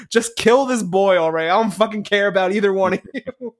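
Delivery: emphatic fed-up rant ending in a laugh; half-joking outburst of impatience; exasperated, infuriated. Retold or imitated. Viewer yelling at the movie's characters, playfully exasperated. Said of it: "it just infuriated me so".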